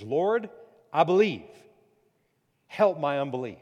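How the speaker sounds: loud pleading cry, a touch of tears. Acted. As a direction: beg the Lord out loud. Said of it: "the father cried out and said with tears".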